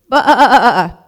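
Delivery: loud, high wavering baa; loudest possible bleat. A narrator's reading. Lost sheep calls to a distant voice, full-force bleating. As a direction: bleat as loud as you can. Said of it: "as loud as she possibly could".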